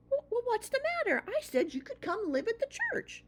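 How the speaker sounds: put-on high, earnest young mouse voice; a small child mouse pleading; little mouse boy. A narrator's reading. Young mouse tells his mother, high child voice. Christopher puzzled, urging.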